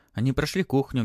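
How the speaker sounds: usual speaking voice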